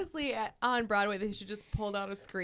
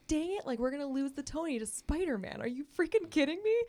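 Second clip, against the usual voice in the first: frustrated creators; 7 semitones higher than usual. Retold or imitated. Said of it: "and they were like".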